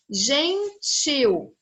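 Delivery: GENTIL in two separate beats, gen-til; split into syllables. Shown as a demonstration. Teacher counting a word's two syllables, syllable by syllable. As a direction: pull gentil apart with a gap. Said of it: "eu também tenho duas sílabas".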